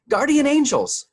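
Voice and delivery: loud, high-pitched shout; Calvin shouting. A narrator's reading. Calvin calling across the table to the beasts, shouting. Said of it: "Calvin shouted suddenly from across the table".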